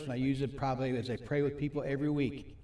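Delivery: ordinary delivery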